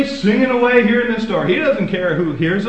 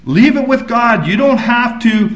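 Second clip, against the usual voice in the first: ordinary talk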